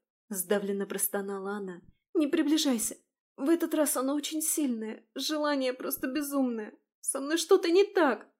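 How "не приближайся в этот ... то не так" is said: high, loud panicked voice; terrified cry. Acted. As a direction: voice rising to a scream of fear. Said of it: "В ужасе закричала Кристина". (в ужасе, с криком)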